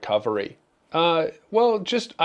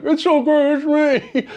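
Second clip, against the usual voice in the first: laughing plea; 7 dB louder than usual. Retold or imitated. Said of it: "I'm like".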